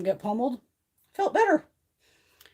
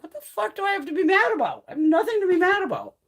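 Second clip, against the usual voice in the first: high, loud self-questioning rant; worked-up mock outburst at yourself; retold exasperation; 10 semitones higher, 7 dB louder than usual. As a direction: act out being wound up and mad. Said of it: "wound up and mad".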